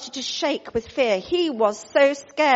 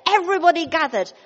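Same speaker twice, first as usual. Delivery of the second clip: usual speaking voice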